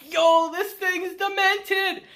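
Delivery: slow, high, stunned disbelief; appalled. Real, not acted. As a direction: stretch it out, horrified. Narrator reacts to evil hedgehog.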